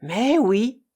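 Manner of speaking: usual speaking voice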